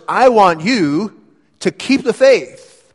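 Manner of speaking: slow, high and loud, stretching out each word; a preacher's impassioned appeal, drawn out; fervent charge. Acted. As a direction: plead with Timothy to hold on. Preacher voicing Paul's charge to Timothy.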